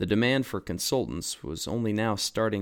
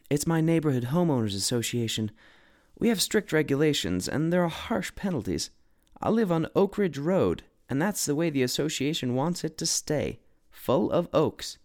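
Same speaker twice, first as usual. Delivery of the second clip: higher, anxious woman's voice; worried woman; 6 semitones higher than usual. A narrator's reading. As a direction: plead the rules nervously. Anxious woman explaining association rules.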